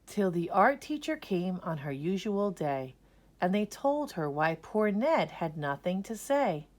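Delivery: ordinary delivery, nothing marked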